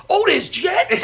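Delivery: high, loud surprised question; surprised father. Retold or imitated. Father in the whirlpool, surprised and high. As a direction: play the dad just discovering jets. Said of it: "I go".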